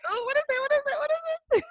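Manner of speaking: very high-pitched, eager squeal of curiosity; breathless-for-gossip "ooh", way up high; excited curiosity. Retold or imitated. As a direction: act out nosy excitement, pitch shooting way up. Acting out her eager curiosity.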